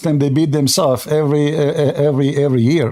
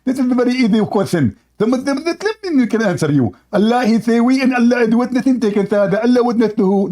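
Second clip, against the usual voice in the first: high-pitched mock Indian debating accent; Zakir Naik; 5 semitones higher than usual. Debater posing a challenge as a preacher, mock Indian accent. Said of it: "I have to speak like Zakir Naik".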